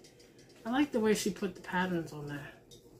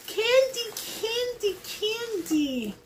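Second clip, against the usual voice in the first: 1 delighted squeal; 12 semitones higher, much slower than usual. Real, not acted. Gasps at candy in box.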